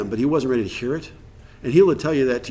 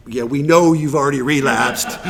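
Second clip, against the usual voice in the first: louder, drawn-out "we know", played for laughs; knowing mock reply; 5 dB louder than usual. Group silently calling out a relapsed friend, knowingly. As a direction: let them know you've seen right through it.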